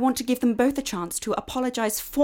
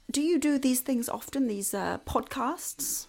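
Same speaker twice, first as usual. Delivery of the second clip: whispering; 8 dB quieter than usual. Acted. Miss Waite leans in curiously.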